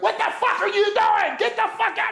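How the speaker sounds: high shrieking mock yell of a furious woman; imitating a woman screaming at him in his van; imitated screaming lady. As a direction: shriek it like an enraged stranger lady.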